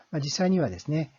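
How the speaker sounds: ordinary delivery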